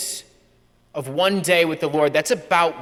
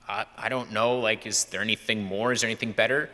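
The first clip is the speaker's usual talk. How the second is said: sheepish; 7 semitones lower, 6 dB quieter, faster than usual. Retold or imitated. Young self answering priest, unsure.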